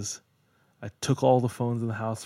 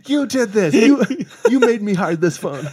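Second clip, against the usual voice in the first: high, loud accusation through laughter; laughing mock yell; 11 semitones higher, 13 dB louder than usual. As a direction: yell it while cracking up. Acted quarrel with parents, laughing.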